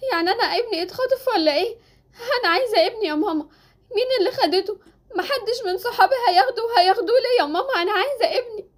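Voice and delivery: high, tearful pleading; tearful panic. Acted. Mother learning her baby was taken, tearful panic. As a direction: plead through rising sobs.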